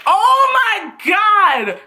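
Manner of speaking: high, slow drawn-out gasp of delight; thrilled shriek. Real, not acted. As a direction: scream with joy. Gushing over Raven look.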